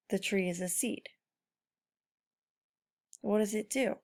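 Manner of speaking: ordinary talk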